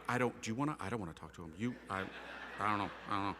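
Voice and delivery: low, hushed, hesitant office murmurs; reluctant murmuring. Retold or imitated. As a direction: imitate nervous onlookers passing the buck. Nervous office workers avoiding him.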